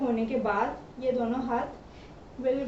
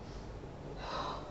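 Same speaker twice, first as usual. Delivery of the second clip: tired sigh; 14 dB quieter than usual. Real, not acted. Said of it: "It's difficult to talk and dance".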